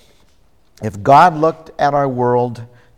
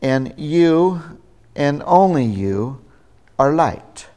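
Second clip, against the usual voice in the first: "you" raised and stressed, "only" leaned on; emphatic you. Shown as a demonstration. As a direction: press "you" and "only you" like a claim on the listener.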